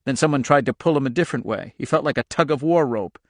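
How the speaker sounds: usual speaking voice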